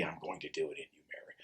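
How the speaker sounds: hushed whisper of God to Mary. Acted. God whispers his decree to Mary, hushed. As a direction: breathe it like a sacred secret.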